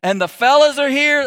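high excited whoop; stoked. Retold or imitated. Pastor voices Mary thrilled at Jesus arriving, high excited whoop. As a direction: cheer like a thrilled fan. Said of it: "Because Mary's stoked".

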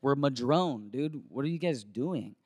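low, soft appeal; retold calm, hushed reproach; slowing everything down. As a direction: talk the kids down quietly. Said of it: "slowing everything down".

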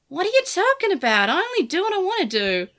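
high, indignant little creature; bristling small monster, defiant; feisty Digimon voice. A narrator's reading. Lugumon voiced by narrator.

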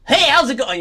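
high, loud friendly hello; cheery greeting. Acted. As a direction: greet them high and bright. Acted greeting.

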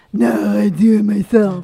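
a lightly voiced gruff husband, a bit slow; mock husband voice. Mocking her husband's refusal to let her help, a light gruff voice. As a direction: put on a dopey grumble for him. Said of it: "I do not sound like that".

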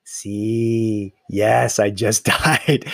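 low, slow, lips barely moving; lightly voiced grandmother feigning death; deadpan dead grandma. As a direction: play dead and answer without moving.

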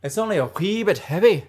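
put-on hamming up a poor Scottish accent; bad Scottish accent. Friend joins the beer banter in a bad Scottish accent. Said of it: "really bad Scottish accents".

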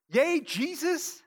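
slow, high, loud imitation of a cheer; mock celebratory cheer. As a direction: cheer like an adoring crowd. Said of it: "who are shouting in celebration".

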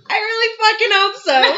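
high, loud, laughing through it; laughing reply to a stepdaughter who was a bad kid; laughing retort. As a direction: laugh out the line in mock dread.

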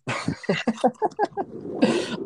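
high, voiceless wheezing laugh; helpless high laughing fit; laughter. Real, not acted. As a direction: crack up in a long breathy laugh. Host laughs at the fake accents.

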